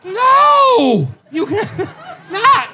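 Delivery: high squeal of disbelief, breaking into a laugh; high laughing protest; shocked no. Retold or imitated. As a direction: yelp no as if horrified. Dad shocked reaction.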